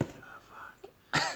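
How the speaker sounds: very soft, voiceless retold curse; stunned whisper. Patient reacting to his cancer news, stunned whisper. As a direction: whisper it as if it just sank in.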